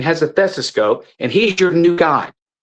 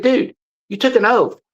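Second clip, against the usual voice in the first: raised, pitched-up retort in the retelling; retold heated retort; 8 semitones higher than usual. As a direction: throw the oath back at him, higher and sharper. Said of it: "I don't like your tone of voice".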